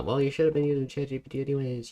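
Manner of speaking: fast, high mock dad voice; imitating dad. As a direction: rattle it off like a preachy dad. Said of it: "My dad's always been on the".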